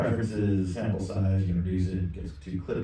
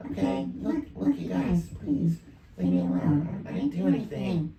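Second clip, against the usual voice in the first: put-on high, pitched-up pleading kid; pleading youngster; 7 semitones higher than usual. Kid telling bullies leave me alone, pitched-up pleading. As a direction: plead innocence in a younger, raised voice.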